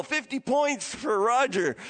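ordinary talk, nothing marked

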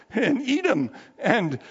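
usual speaking voice

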